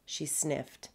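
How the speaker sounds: ordinary delivery, nothing marked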